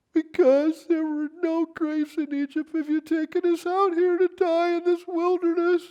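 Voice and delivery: high, whining mock complaint; whiny complaining Israelites. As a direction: whine it like frightened grumblers. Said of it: "That'd make a good song on Hee Haw".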